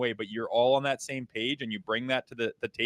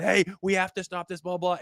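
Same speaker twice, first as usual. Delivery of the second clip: lightly mocked panicky coach, pitched up; freaking-out coach; 6 semitones higher than usual. Opposing coach yelling at players under pressure, mock freak-out. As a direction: imitate a rattled coach calling out, higher. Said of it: "their coach is freaking out going".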